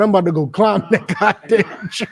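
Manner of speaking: laughing while imitating him; laughing through it. Preacher mocking Tory to the crowd, laughing. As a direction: crack up mid-quote.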